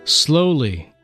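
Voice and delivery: usual speaking voice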